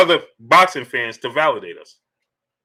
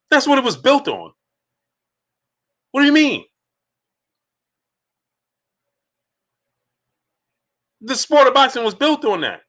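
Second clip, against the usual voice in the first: pitched-up, fired-up questioning; higher, indignant challenge to the chat; impassioned rant; 8 semitones higher than usual. Real, not acted. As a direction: hammer each question home, voice riding high. Indignant lecture to a dissenter.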